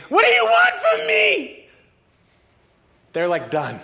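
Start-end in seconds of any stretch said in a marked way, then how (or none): 0.1-1.7 high, drawn-out demonic scream. Acted. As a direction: scream it at full force, in torment. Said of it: "running and screaming towards it".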